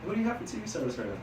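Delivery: high, quick, friendly pitch; upbeat sales opener. Shown as a demonstration. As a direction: catch them with energy. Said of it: "I'm gonna be like".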